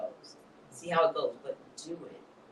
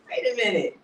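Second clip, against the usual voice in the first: higher and louder, laughing through a mock protest; 7 semitones higher, 6 dB louder than usual. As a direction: laugh while pushing back. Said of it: "you're like".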